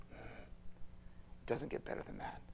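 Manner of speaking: soft hushed earnest whisper; quiet intimate sermon close; whispered promise. Real, not acted. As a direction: draw them close and whisper it.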